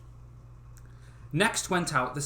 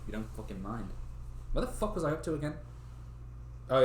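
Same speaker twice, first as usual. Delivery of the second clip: usual speaking voice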